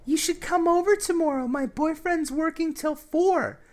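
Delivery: mocking the girl inviting him over, high and coy. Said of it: "our conversation is going something like this".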